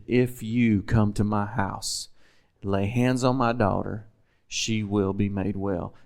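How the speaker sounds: each phrase laid out slowly and softly; quietly declaring the words of faith; slow, soft declaration. Shown as a demonstration. Preacher slowly quoting Jairus's declaration to the congregation. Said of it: "And this was his declaration".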